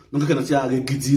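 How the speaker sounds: ordinary talk, nothing marked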